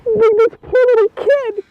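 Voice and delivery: barely holding it together; crying. Real, not acted. Mother reliving her son's relief, openly crying. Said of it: "it just gets me every time".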